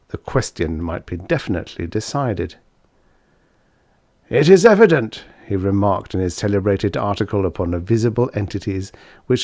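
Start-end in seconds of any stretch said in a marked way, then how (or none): 4.3-5.2 louder and higher, a lecturing professor proclaiming; professor's declaration. Acted. Professor proclaiming his theory to the scientific world, loud and high. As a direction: announce it like a confident scholar.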